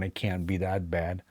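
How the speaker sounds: usual speaking voice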